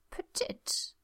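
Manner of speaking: high, soft cooing of petite. Acted. Gwen cooing at the shrunken beast. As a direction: coo it like to a puppy. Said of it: "Gwen cooed".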